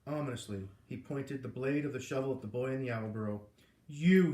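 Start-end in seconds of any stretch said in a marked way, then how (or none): 3.9-4.3 much louder menacing bellow. A narrator's reading. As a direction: bellow at the boy. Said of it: "he bellowed".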